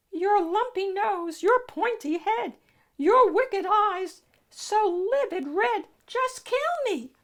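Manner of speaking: very high, sweet princess voice. Acted. Voicing the princess.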